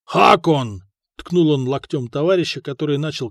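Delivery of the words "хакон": calling a comrade. Acted. Nudging a dozing comrade awake.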